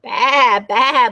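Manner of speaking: lightly imitated sheep bleat. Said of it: "bleated Sheep".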